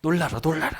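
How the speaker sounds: forceful, loud bursts; acted stern commands; displeased divine command. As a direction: order them to look, as God. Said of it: "이렇게 명령을 하시는 거는".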